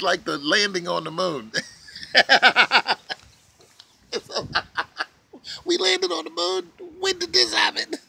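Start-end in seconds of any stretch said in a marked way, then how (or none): 1.6-3.1 bursts into a loud high laugh; real loud laughter; loud high cackle. Real, not acted. Cracks up. 5.6-7.9 much higher, mocking squeal; high mocking sarcastic voice; mock incredulous. Mocking moon landing believers.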